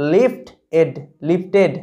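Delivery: লিফট, এড, then joined as লিফটেড; syllable-by-syllable demo. Shown as a demonstration. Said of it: "দেখো একই রকমভাবে". (লিফট আর এড ভেঙে, তারপর জুড়ে লিফটেড উচ্চারণ)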